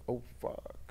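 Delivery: breathless whispered shock; stunned whisper. Retold or imitated. Chino realizes it's really Pap, whispering in stunned shock. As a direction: let it slip out under the breath.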